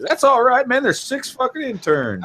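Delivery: breezy high buddy voice. Retold or imitated. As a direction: toss it off like a chill boss. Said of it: "my buddy".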